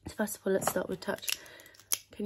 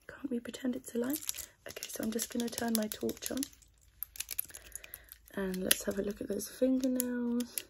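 ordinary delivery

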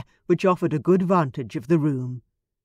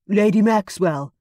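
major domo intoning; 4 semitones higher, 5 dB louder than usual. Acted. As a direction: announce the name formally. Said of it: "the major domo intoned".

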